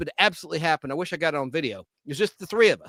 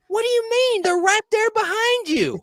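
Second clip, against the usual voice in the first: much higher and louder, slowing on the last words; indignant customer; 5 dB louder than usual. Retold or imitated. Woman demanding rolling papers from the clerk, high indignant voice. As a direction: play the outraged lady, pitched way up.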